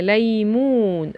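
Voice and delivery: ليمون said slowly with both syllables stretched; drawn-out demonstration. Shown as a demonstration. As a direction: sound out ليمون for young learners. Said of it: "ادي الصورة اللي فيها لا". (نطق ليمون ببطء ومد المقاطع)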